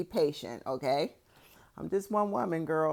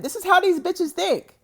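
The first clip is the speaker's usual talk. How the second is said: outraged exclamation; 11 semitones higher, 5 dB louder than usual. Real, not acted. Outraged comment on his family.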